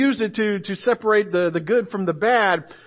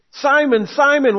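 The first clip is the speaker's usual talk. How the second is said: raised, louder call of the name; urgent call; 7 semitones higher, 6 dB louder than usual. Retold or imitated. Pastor voicing Jesus calling Peter, raised and urgent. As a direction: call him sharply to attention. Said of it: "Satan separates".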